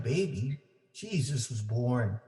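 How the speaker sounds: ordinary delivery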